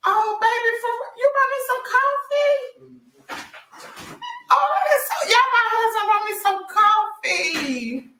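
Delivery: high-pitched delight, half sung; delighted squeal. Real, not acted. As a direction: gush over the surprise coffee. Husband surprises her with coffee.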